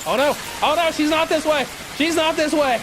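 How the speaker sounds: panicked high yelling; being chased. Real, not acted. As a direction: scream in panic while fleeing. Fleeing killer nun in game.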